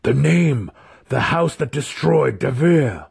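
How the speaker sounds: high, forceful cry; urgent demand. A narrator's reading. Wizard demanding his enemy's name from the spirit, urgently.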